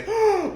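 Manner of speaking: sharp high mock gasp; imitated gasp. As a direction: suck in a shocked gasp. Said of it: "I heard somebody say".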